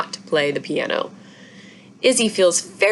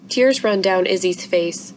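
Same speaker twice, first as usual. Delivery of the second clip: ordinary delivery, nothing marked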